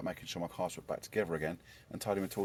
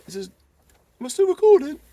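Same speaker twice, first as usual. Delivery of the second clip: high, loud squeaky question; silly squeak; 15 dB louder than usual. Pretend. Vlogger checking his camera, in a high squeaky voice. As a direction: squeak it like a cartoon.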